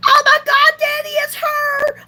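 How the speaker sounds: high, loud mock squeal; hammed-up fangirl shriek; imitated excited fan. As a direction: squeal it like a starstruck fan, drawing out the end. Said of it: "And she was so excited".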